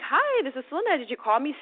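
high-pitched, cheery phone greeting; sunshine and rainbows. Retold or imitated. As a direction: answer sweetly, all sunshine. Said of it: "Still sunshines and rainbows".

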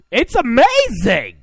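loud, high, drawn-out yell; slow, hammed-up shouted catchphrase; exclaiming. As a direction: stretch it out in a big shout. Catchphrase shouted over the break.

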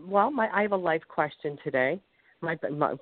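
usual speaking voice

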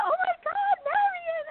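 high, emotional cry of recognition; imitating her tearful surprise; teary. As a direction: retell it like you're choking up. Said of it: "And I'm crying".

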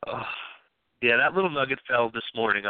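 usual speaking voice

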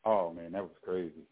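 ordinary talk, nothing marked

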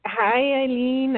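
ordinary talk, nothing marked

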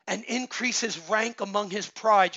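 usual speaking voice

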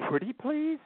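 high pleading little voice; a child's high pretty-please wheedle; wheedling child. Retold or imitated. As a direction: coax like a child asking for a cookie. Daughter begging in story.

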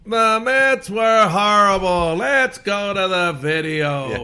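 drawn-out, booming high-pitched highlight-reel call; Warner Wolf impression. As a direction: stretch it out like a TV sports anchor hamming it up. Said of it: "You remember Warner Wolf?".

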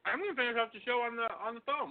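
talking through M and M's; chewing while talking; mouth full. Real, not acted. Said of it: "your mouth is full".

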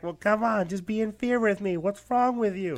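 high, loud, pleading mock voice; mocking a fearful masker. Imitating a scared mask wearer pleading with him, high mock whine. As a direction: whine it like someone begging you to share the fear. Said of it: "And it's like".

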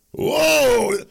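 a high, loud mock gasp of alarm; mock surprise. Mocking listeners who fear the truth, mock surprised whoa. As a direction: fake shock, hammed up.

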